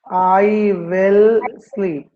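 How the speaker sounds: drawn-out "I will sleep", word by word; teacher slowly spelling out the sentence; slow model sentence. Shown as a demonstration. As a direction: stretch each word for the class.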